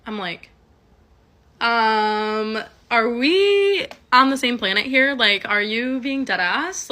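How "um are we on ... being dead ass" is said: drawn-out, pointed incredulity, pitched up; incredulous disbelief. Retold or imitated. Wife answering friend's news of husband's cheating, incredulous. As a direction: stretch the words out in disbelief, louder on the question.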